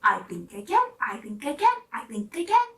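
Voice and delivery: rising, building chant; determined little engine. Acted. Little blue engine deciding to try, building determination. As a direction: build courage line by line.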